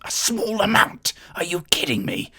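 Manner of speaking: a touch of aggravated posh outrage; aggravated noble. Acted. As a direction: snap it back in disbelief, posh and high. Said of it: "starts to get quite aggravated".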